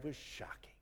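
breathy stage whisper of awe; dramatic whisper. Acted. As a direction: whisper "was shocking" for effect. Dramatic point after pause.

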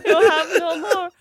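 high, laughing fake sob; mock bawling. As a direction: wail it through pretend tears. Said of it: "bawling my eyes out".

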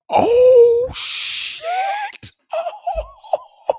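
high, loud yelp of shocked laughter; real disbelieving screech; shocked laughing shout. Real, not acted. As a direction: burst out in a squealing laugh. Reacting to chat message live.

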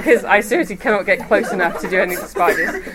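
ordinary talk

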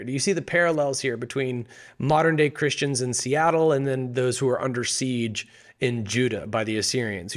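normal talking voice

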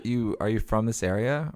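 normal talking voice

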